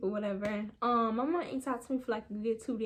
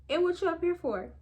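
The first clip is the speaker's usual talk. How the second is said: higher, sharp demand from mom; mom's sharp question; 6 semitones higher, 3 dB louder than usual. Retold or imitated. Mom spotting her daughter and demanding why she's there, sharp. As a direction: snap out the question as the surprised mom. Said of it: "my mom looked at me and she was just like".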